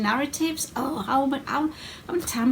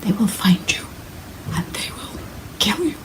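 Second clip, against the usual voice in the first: joking Liam Neeson; 3 dB louder than usual. Acted. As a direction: play at a thriller hero's threat, hushed by the end. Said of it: "doing an impression of Liam Neeson".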